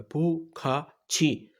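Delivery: "پو کھا چھی" slowly, each syllable apart; syllable-by-syllable model. Shown as a demonstration. Teacher shows learners how to read it, slow syllable-by-syllable model. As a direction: sound out "پو کھا چھی" with gaps between syllables. Teacher sounds out the new word.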